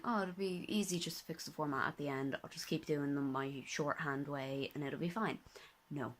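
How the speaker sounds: usual speaking voice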